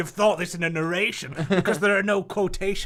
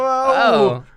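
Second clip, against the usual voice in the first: surprised whoa; 10 semitones higher, 11 dB louder than usual. Real, not acted. Startled at the scrolling screen.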